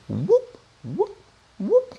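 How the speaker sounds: high whoop like a falling water drop. Shown as a demonstration. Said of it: "Essayez d'analyser ce qui se passe".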